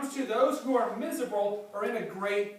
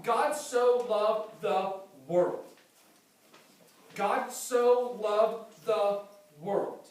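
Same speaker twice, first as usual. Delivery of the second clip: slow emphatic verse; 4 dB louder, much slower than usual. Acted. Slowed quoted verse.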